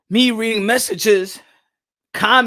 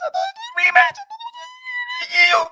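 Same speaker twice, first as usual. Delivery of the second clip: hammed-up crying scream; mock anguished scream. Defending himself to Canelo fans, screaming in mock anguish.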